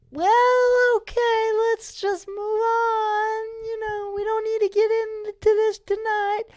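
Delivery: very high falsetto mom; mom imitation. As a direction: mimic a mother nervously changing the subject in falsetto. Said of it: "immediately my mom went".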